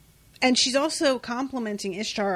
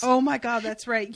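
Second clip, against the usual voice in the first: ordinary talk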